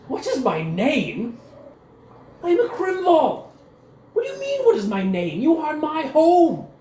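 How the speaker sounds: a pampered lord sputtering at the insult; outraged noble. Acted. Captured noble to Cecil, high indignant outrage.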